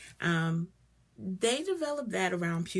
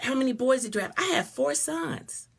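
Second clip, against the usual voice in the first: ordinary delivery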